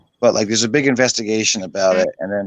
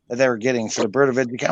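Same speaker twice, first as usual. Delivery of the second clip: ordinary talk, nothing marked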